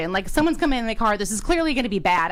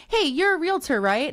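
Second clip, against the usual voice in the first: stranger asking; 8 semitones higher than usual. Retold or imitated. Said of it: "And they're like".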